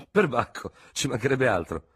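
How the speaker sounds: lieutenant bursting out at the doctor's hint; outburst. A narrator's reading. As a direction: blurt it out, indignant. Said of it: "proruppe Dubert".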